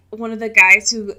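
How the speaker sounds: normal talking voice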